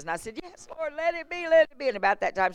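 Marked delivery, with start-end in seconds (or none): 0.4-1.9 high, urgent retold prayer; pleading prayer. Fervent prayer during healing.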